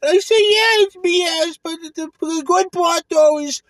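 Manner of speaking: high, loud New York pizza-guy voice; mock Brooklyn pizzeria guy. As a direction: ham up a Brooklyn pizza owner.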